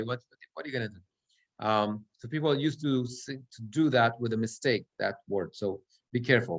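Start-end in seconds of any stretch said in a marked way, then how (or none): none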